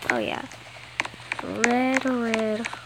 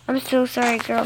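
usual speaking voice

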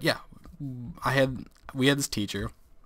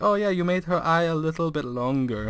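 higher mock Chinese-accented teacher; put-on Chinese accent; Chinese accent impersonation; 6 semitones higher than usual. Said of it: "my Chinese impersonation".

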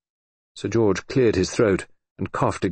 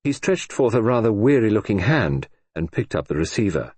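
plain reading voice

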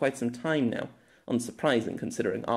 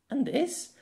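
high, curious and eager; soft excitable question; 14 semitones higher than usual. Acted. Girl asking Pierre about breakfast foods, soft and excitable. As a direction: ask it with bright wonder, pointing at the food. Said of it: "asked the soft but excitable voice".

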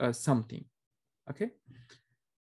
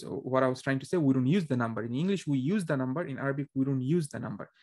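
normal talking voice

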